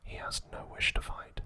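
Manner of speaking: plain reading voice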